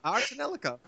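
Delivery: cracked squeaky voice; voice cracking. Real, not acted. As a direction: voice cracks on the word. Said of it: "is my voice just cracked?".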